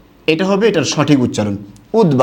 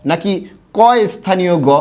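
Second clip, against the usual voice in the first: ordinary talk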